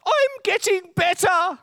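put-on very high, slow frail old-man voice. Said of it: "says the old man".

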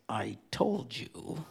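low, soft, drawn-out reproach; pointed I-told-you-so. Retold or imitated. Wife looking at her husband, slow quiet I-told-you-so. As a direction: stretch it out slowly under your breath.

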